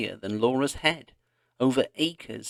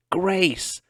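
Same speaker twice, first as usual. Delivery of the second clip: high, loud scream of the name; 9 semitones higher, 3 dB louder than usual. A narrator's reading. Laura screaming for Grace as she runs, breathless. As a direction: scream out while running. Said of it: "she screamed".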